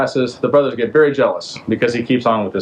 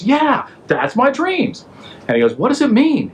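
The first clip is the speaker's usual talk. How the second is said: much higher, slow, silly put-on voice; comic Pharaoh voice; 13 semitones higher than usual. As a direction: play Pharaoh as a squeaky, bewildered king. Pharaoh voiced in retold story.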